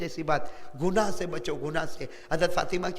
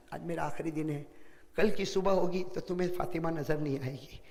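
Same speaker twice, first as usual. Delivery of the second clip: soft, fading farewell words; quietly voicing Fatima's farewell to Ali; faint dying voice; 4 semitones lower, 9 dB quieter than usual. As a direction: let the voice sink, barely above a breath. Dying Fatima repeats her farewell.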